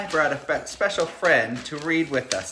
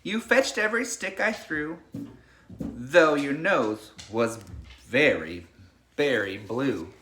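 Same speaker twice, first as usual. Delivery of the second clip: put-on reading to children. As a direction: lull the class like a bedtime story. Reads picture book to class.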